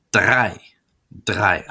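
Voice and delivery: "drei" said like "try" with a German r; teacher modelling "drei"; model pronunciation. Shown as a demonstration. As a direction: repeat "drei" clearly for learners. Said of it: "Drei kind of sounds like tri".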